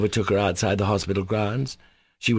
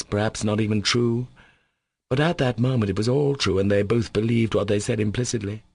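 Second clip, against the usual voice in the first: usual speaking voice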